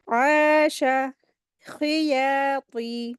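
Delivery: very slow drawn-out reading of each word; slow recitation. Shown as a demonstration. Teacher to class, reading new words: very slow recitation. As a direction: recite slowly, stretching the vowels. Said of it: "खी को जल्दी पढ़ेंगे".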